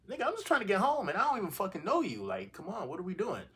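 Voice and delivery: high-pitched, indignant retold outburst; exasperated protest. Storyteller replaying his answer to a distrustful stranger, exasperated. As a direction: protest incredulously, pitch leaping up. Arguing with stranger over trust.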